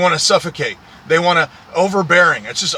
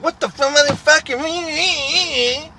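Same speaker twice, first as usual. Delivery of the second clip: high, slow mocking shriek of a furious woman; mock screeching woman; 10 semitones higher, much slower than usual. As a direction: stretch it out in a shrill mock screech.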